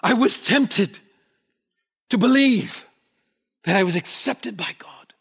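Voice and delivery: half-acted yell of a man jolted awake; retold yell. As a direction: cry it out like waking from a nightmare. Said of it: "he yelled out".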